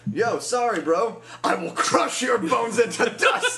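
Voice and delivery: high, slow menacing dude voice; tough guy voice. Retold or imitated. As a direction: threaten like a cartoon bro. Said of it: "That brainwashing video".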